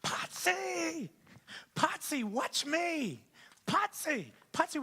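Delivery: put-on high excited child's call, shouted across the pool; squeaky little-girl yell from the pool; granddaughter yelling. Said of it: "she starts yelling".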